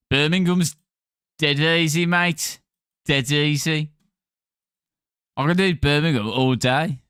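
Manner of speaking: broad Brummie accent; easy-going Brummie boast; Birmingham accent. Shown as a demonstration. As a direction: put on a Birmingham accent, dragging the words. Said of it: "try and do your accent".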